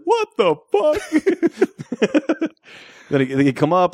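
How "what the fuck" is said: drawn out, very high, laughing; imitating the line. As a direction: stretch it slowly in laughing disbelief. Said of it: "The way he says it".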